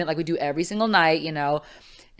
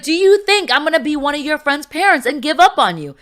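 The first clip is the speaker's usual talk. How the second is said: higher, louder, pressing question; insistent mom; 11 semitones higher, 7 dB louder than usual. Retold or imitated. Mother confronts her daughter at three a.m., insistent. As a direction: drive the question home. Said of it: "She's like".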